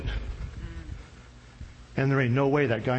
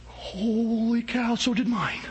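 higher, slow, wide-eyed holy cow; amazed James; 8 semitones higher, slower than usual. Acted. James tells the crowd his bread grew too, amazed. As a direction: stretch out the wonder, pitched up.

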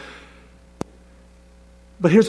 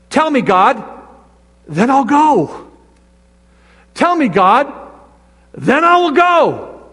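high, rising "Tell me, God", then a drawn-out "go"; mock pleading believer; 7 semitones higher, slower than usual. Preacher mimicking believers who wait on God, higher and pleading. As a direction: play the demanding believer, lightly mocking. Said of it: "We say this".

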